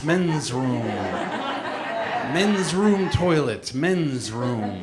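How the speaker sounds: slow, stretched-out warm-up phrases; mock vocal warm-up. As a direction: intone it like a pretend vocal exercise. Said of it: "Doing my vocal warm-ups now".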